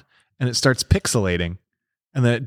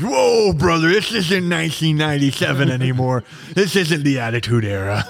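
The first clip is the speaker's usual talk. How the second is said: a hint of a hammed-up wrestler, pitched up; Ric Flair voice; 4 semitones higher than usual. The GM voicing Ric Flair to the players, mock wrestler. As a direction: lightly do a wrestling promo. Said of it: "Pick Flair in the corner of the room goes".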